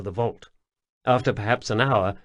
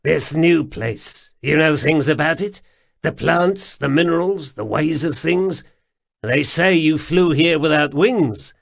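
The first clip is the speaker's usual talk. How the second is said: higher, louder, eager and coaxing; coaxing underground man; 5 semitones higher, 5 dB louder than usual. A narrator's reading. Wemreddle coaxes the pair to come with him, higher and eager. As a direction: beckon them along, keen to please.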